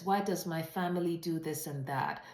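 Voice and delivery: ordinary talk, nothing marked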